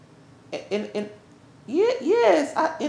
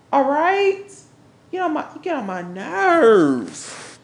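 ordinary delivery

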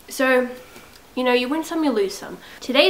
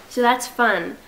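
usual speaking voice

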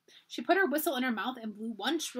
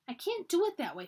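curt; 6 semitones higher than usual. A narrator's reading. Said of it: "said Susan shortly".

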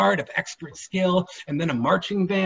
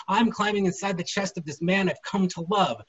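ordinary delivery

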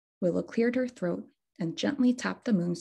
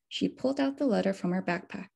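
ordinary delivery

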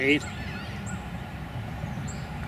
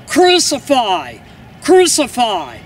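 crowd crying out; 4 semitones higher, 6 dB louder than usual. A narrator's reading. The crowd spurred on by the leaders, crying out. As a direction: cry it out with a long falling end.

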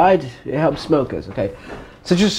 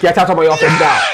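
loud order to leave; angry dismissal; 5 dB louder, faster than usual. Acted. Interviewer ordering threatening applicant out, angry shout. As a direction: throw them out with raised voice.

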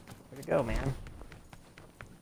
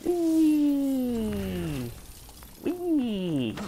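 high, gleeful whee on a falling glide; 10 semitones higher than usual. Real, not acted. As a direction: squeal it like sliding down. Gliding in game.

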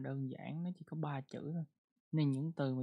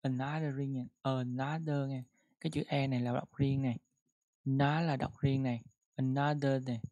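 another stressed at the start, louder; 3 dB louder than usual. Shown as a demonstration. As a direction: hit the first syllable of another. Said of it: "nhấn là nhấn từ another".

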